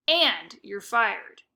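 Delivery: very slow, each word spaced and firm; curt firing. Acted. Grocer Mister Snood firing the bag boy, slow and firm. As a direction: lay down the dismissal deliberately. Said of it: "he added".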